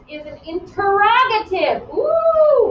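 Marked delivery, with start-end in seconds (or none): 1.9-2.7 playful ooh. Real, not acted. Teacher's playful surprise.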